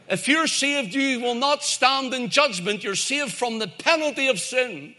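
high-pitched, emphatic pulpit assurance; driving sermon delivery, pitched well up; forceful preaching. Real, not acted. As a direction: proclaim it to the congregation with conviction.

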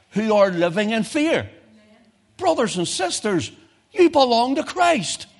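high, loud, ringing preacher's cry; preaching at full force. Real, not acted. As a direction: preach it out to the whole hall.

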